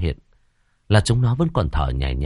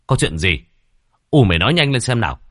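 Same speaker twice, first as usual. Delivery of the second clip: pressing questions; 3 semitones higher, 5 dB louder, faster than usual. Acted. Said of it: "bấy giờ mới hỏi dồn".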